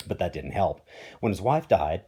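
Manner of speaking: plain reading voice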